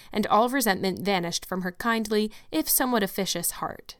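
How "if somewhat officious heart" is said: plain reading voice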